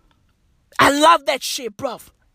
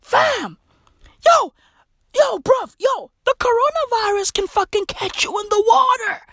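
much higher, shouting; excited; 12 semitones higher than usual. Retold or imitated. Narrator shouting a warning to the scared man. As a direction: yell the warning at him. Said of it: "I be like".

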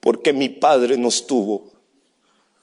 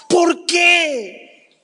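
shouted why. Shown as a demonstration. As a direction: cry out "¿Por qué?" to heaven. ("¿Por qué?" gritado con angustia)

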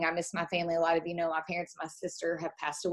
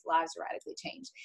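hushed emphasis; 5 semitones higher, 9 dB quieter than usual. Real, not acted. Repeats point for emphasis.

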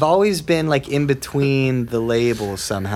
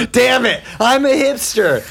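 mock dismay; 8 semitones higher than usual. Guest realizing he is a hipster himself, mock dismay. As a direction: cry out in exaggerated self-discovery.

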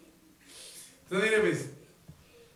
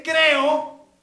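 loud high "Creo"; father's loud cry of faith; 6 semitones higher, 13 dB louder than usual. Acted. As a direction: shout the word like the father. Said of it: "Si dice".

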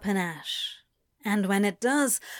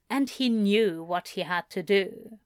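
plain reading voice

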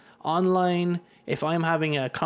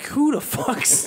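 higher, slow, far-off outraged voice; high slow mock outrage; 9 semitones higher, much slower than usual. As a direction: drag it out in disbelief. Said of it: "I'm like".

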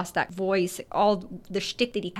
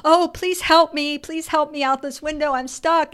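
imitating a high-pitched plea for help; mock-panicked damsel calling for rescue; panicked pleading; 7 semitones higher, 5 dB louder than usual. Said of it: "starting to get worried and panicked".

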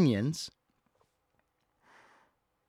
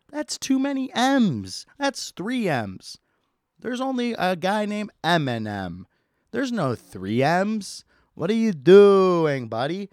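high, loud, slow outrage; exasperated; 7 semitones higher, 6 dB louder than usual. Acted. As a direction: rant in disbelief. Exasperated rant at customer.

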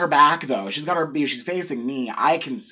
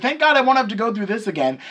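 high, louder mock relief; exaggerated relief; 7 semitones higher, 4 dB louder, faster than usual. Cashier thinking customer is finally done, exaggerated relief. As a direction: raise pitch and voice in dramatized relief. Acting out her relieved thought.

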